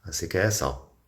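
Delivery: ordinary talk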